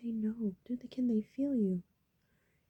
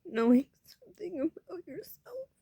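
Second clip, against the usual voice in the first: near tears; 8 semitones higher, 5 dB louder than usual. Real, not acted. Emotional confession, voice breaking.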